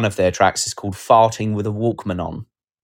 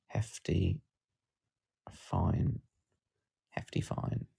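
whispering; 16 dB quieter, much slower than usual. Real, not acted. Reading album title quietly.

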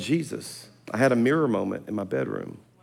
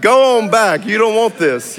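hammed-up, higher and louder keep-away message; mock warning off; 8 semitones higher, 6 dB louder than usual. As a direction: shoo the stranger off that seat, half laughing. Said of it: "I'm sending every message like".

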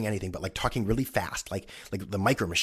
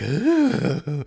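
mock groan; 7 semitones higher, 8 dB louder than usual. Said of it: "He's like".

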